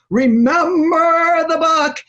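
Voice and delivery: booming high-flown slow divine proclamation; hammed-up voice of God, very slow; Charlton Heston God voice. Shown as a demonstration. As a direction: epic movie-God delivery, loud and drawn out. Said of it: "like the voice of Charlton Heston".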